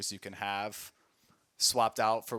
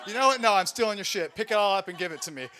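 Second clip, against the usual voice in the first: high, loud joking threat; mock robber; 8 semitones higher, 8 dB louder than usual. Narrator teasing the stranger, mock robber. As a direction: fake a hold-up, grinning.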